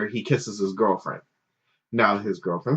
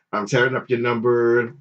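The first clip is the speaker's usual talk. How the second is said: ordinary delivery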